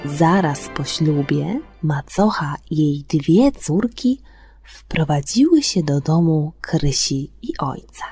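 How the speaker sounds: ordinary delivery, nothing marked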